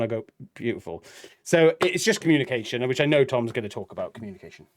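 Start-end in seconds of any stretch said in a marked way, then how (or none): 0.5-1.0 low, quiet retold compliment; soft approval. Husband approving wife's second dress, soft approval.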